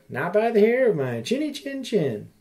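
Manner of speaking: a hint of a squeaky little pig; lightly voiced piggy reply. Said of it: "Little pig said".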